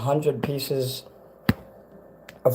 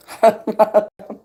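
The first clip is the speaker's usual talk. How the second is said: laughing; 8 semitones higher, 4 dB louder than usual. Real, not acted. Laughs at own overfull plate.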